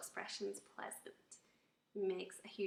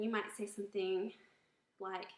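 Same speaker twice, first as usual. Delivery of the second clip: ordinary delivery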